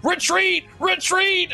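high panicked retreat yell; panicked soldiers. Acted. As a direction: squeal it like fleeing toy soldiers. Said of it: "all of these soldiers turn around and go like".

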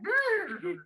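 a lip-trilled horse snort; horse impression. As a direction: blow a horse snort through your lips. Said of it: "my impression of a horse".

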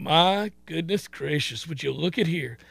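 acted under-the-breath astonishment; hushed amazement. Recalls his awe at the game.

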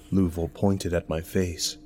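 plain reading voice

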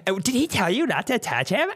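high, catty gossip; high mocking tech lady. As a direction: sneer at him behind the door, lightly. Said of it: "she's like".

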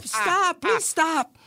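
hammed-up high-pitched panic; little-girl scream. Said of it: "screaming like a little girl".

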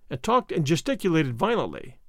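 ordinary delivery

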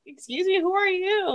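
mock affront. Imagined crying passenger to nosy seatmate, mock affront. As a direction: play-act being taken aback by a stranger. Acting out an intrusive stranger's awkward approach.

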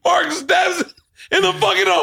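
very high, near-crying squeal; hysterical high retelling. Real, not acted. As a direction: tell it in a squeaky, overwrought wail. Horrified, hysterical retelling of the kill.

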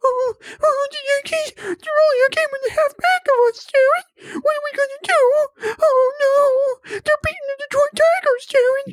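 high falsetto whimper with fake crying; mocking scared fan. Mocking Tyler's fear of the Yankees, high fake sobbing. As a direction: wail it like a panicked child. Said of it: "You sound like courage".

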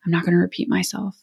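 soft, quick and restrained; really quiet. Retold or imitated. Mother who wants to yell at the kids, getting really quiet. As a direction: drop your voice instead of yelling. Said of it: "I'll just get really quiet".

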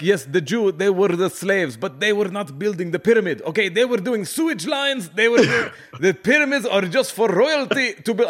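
high, insistent put-on voice, laughing by the end; mimicking Egyptian friends. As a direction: set them straight as a know-it-all Egyptian friend, pitched high. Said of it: "they're like".

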